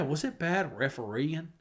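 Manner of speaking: a slow mock Southern "refereeing" with no G; lightly imitating his Southern slip; Southern accent. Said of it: "That's a very Southern thing".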